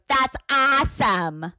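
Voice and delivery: slow, high mock-American; drawn-out exaggerated American accent; imitated American accent. Foreigners mimicking us, mock American accent. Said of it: "everyone always goes".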